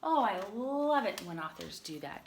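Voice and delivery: ordinary delivery, nothing marked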